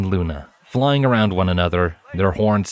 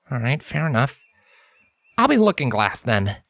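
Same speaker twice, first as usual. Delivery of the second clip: higher, earnest scholarly stallion, serious; Lens's voice; 5 semitones higher than usual. A narrator's reading. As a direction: speak as the studious unicorn, intent on his work. Narrator voices Lens.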